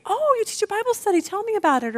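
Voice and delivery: high-pitched mock curiosity; eager interest. Hoped-for reply from the massage girl to a Bible teacher, eagerly interested. Imagined eager question from the masseuse.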